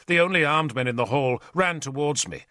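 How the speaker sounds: usual speaking voice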